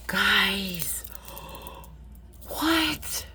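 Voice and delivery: breathless whispered wonder; awed whisper. Real, not acted. Marvelling at the beads, whispering in awe. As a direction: whisper it, stunned.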